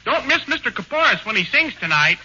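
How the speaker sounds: ordinary talk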